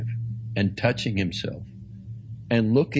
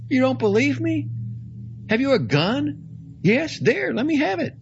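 high, insistent priest's voice; eager old priest; 4 dB louder than usual. Acted. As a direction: press him, eager to prove it. Peregrine's voice held in dialogue.

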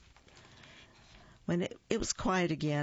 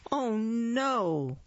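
startled cry; 6 semitones higher than usual. Acted. Ball bounces at bird.

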